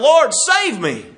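crying out. Acted. Peter sinking in the water, crying out to Jesus. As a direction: cry out slowly, voice lifted high in a plea. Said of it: "and he cries out".

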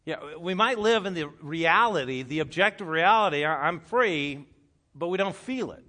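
loud preaching at full force; preaching loudly. Real, not acted. Pastor preaching loudly to the congregation. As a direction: raise the voice to drive it home. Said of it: "preaching at full force".

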